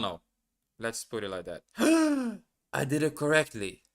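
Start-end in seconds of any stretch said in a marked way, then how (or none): 1.7-2.4 loud, high gasp; surprised gasp. Real, not acted. Learner finds the answer was correct, surprised gasp. 2.7-3.8 higher, louder and amazed; delighted surprise. Real, not acted. Learner finds the answer was correct, delighted surprise.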